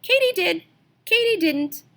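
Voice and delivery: high, squeaky insect calls; katydid voices. Acted. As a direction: chirp it like arguing katydids. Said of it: "the katydids began to argue".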